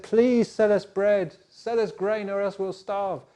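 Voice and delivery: high, loud, desperate begging; pleading brothers. Acted. Hungry brothers begging the king for food, loud and high.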